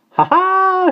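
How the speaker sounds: very high, loud bird cackle; jeering starling screech; rude shriek. A narrator's reading. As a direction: shriek a mocking bird laugh. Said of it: "shrieked the starling rudely".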